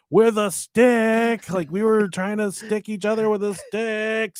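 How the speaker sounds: slow, loud drawn-out explaining; slow defensive excuse. Retold or imitated. Kid explaining to mom it was only a stick, slow and loud. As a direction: spell it out defensively. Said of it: "And I'm like".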